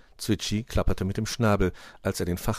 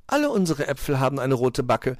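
higher-pitched, smiling reassurance; smiling Schneewittchen; 6 semitones higher, 4 dB louder than usual. A narrator's reading. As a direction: say it with a smile, pitched up. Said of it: "sagte sie lächelnd".

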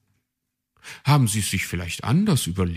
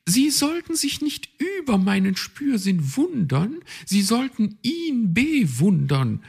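much higher, proud and emphatic; high magpie setting things straight; 6 dB louder than usual. A narrator's reading. Magpie corrects the raven, high and proud. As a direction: put him firmly in his place. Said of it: "stellte Frau Elster klar".

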